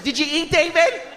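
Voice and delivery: high, fretful mom voice; nagging mother. Acted. Mom checking that son eats, nagging. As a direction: badger your son in a high voice. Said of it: "makes me feel like I'm stupid".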